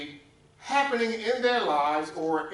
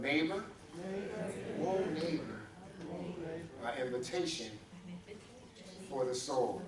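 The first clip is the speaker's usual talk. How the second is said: hushed aside; 8 dB quieter than usual. Shown as a demonstration. Preacher has congregation quietly tell a neighbor, hushed. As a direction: say it quietly, spacing out each phrase. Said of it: "Find a neighbor and whisper to them".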